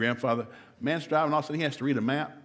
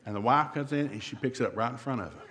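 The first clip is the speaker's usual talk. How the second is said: ordinary talk, nothing marked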